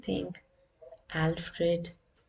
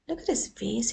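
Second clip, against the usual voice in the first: ordinary delivery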